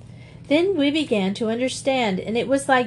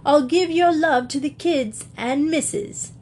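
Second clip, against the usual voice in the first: higher, cocky burglar's parting jab; cheeky burglar; 6 semitones higher than usual. A narrator's reading. Burglar taunting the children as he escapes, cocky. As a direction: toss it off like a sly thief. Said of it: "had the cheek to put his head in".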